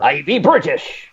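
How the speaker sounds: cartoon pirate insisting he's British; silly pirate voice, drawn out; mock pirate Brit. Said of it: "The pirate?".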